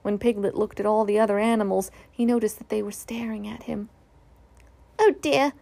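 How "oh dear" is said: small high anxious squeak; nervous squeak. A narrator's reading. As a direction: squeak it like a nervous little pig. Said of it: "he squeaked".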